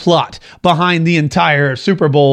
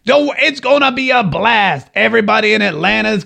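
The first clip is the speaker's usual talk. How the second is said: Herman Cain impression; 5 semitones higher than usual. Host mimics Herman Cain promising a blast in Atlanta, exuberant impression. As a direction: stretch it out high in a put-on voice. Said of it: "Because that's how he talks".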